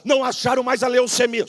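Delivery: loud, high shout on não; shouted proclamation. Acted. Pastor first shouts the miracle to the church, loud. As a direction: shout the line out like a victory. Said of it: "Seguro glória".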